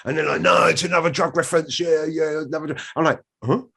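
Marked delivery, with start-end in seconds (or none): 0.4-2.8 insistent accusation. Retold or imitated. Retold crew accusing him. 3.4-3.6 high-pitched puzzled questioning; raised, mock-bewildered query; baffled innocence. Feigned innocence to accusers.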